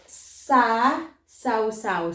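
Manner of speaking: "Sa sausaw" said very slowly, the extra sa set apart; slow sounding out. Shown as a demonstration. Teacher showing the added syllable to learners, very slow. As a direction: spell it out by ear, sa then sausaw. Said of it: "there's an extra S and A there".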